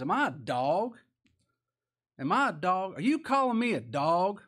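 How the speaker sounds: increasingly loud, offended questions; preacher acting an insulted giant; appalled Goliath. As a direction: build the indignation with each repeat. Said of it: "just appalled at this".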